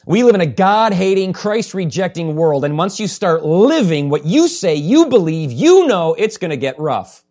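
loud preacher's declaration, key words punched; forceful preaching. Real, not acted. Preacher warning believers about a God-hating world, forceful and loud. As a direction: preach it hard at the congregation.

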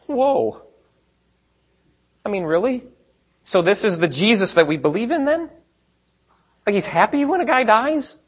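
rising, incredulous questioning; mock disbelief. Preacher voicing a listener's shock at the verse, incredulous. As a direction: act taken aback, pitch climbing.

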